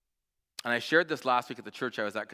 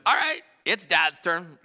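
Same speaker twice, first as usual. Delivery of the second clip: put-on higher, louder and slower, like a young daughter; little girl voice; 6 semitones higher, 7 dB louder, slower than usual. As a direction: play the small girl claiming her turn.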